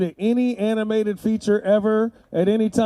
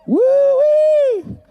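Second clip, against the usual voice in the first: loud high whoop of joy; 7 dB louder than usual. Real, not acted. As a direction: let out a big excited whoop. Loud cheer.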